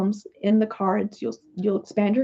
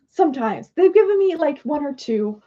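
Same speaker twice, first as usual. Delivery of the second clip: ordinary delivery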